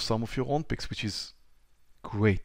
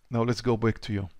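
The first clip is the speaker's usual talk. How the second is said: usual speaking voice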